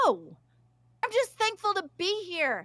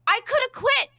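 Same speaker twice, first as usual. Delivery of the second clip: high, squeaky protest; real upset pushing the pitch up; squeaking, upset; 4 semitones higher than usual. Real, not acted. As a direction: voice climbing high as you get worked up. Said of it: "I'm squeaking. I'm really loud".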